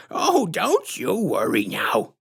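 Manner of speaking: high, slow sugary put-on voice, far above the narrator; sickly sweet menace, drawn out; syrupy sinister clown woman introducing herself; creepy clown lady. A narrator's reading.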